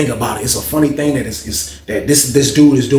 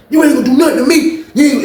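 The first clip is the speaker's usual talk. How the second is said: laughing taunt; 11 semitones higher, 4 dB louder than usual. Retold or imitated. Coach taunting angry player.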